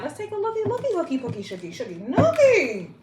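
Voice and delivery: goofy sing-song rhyming; silly singsong. Pretend. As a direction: tease it like baby talk. Silly rhyming baby talk.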